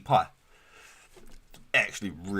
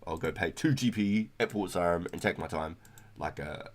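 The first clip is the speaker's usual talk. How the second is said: usual speaking voice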